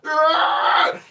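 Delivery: loud, high, drawn-out mock scream; apoplectic scream. Imitating someone's reaction to teasing, apoplectic scream. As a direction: shriek in exaggerated outrage. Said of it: "almost getting apoplectic or whatever".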